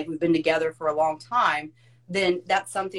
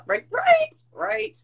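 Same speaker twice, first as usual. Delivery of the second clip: squeaky high; 3 dB louder, much slower than usual. Real, not acted. Joking agreement, very high.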